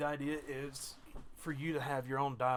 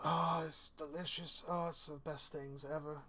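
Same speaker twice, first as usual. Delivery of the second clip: put-on drunk dwarf savouring; 3 semitones higher, slower than usual. Dwarf delighting in his brandy after drinking, slow tipsy bliss. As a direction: sigh the words out after a swig. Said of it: "takes a big swig, and he goes".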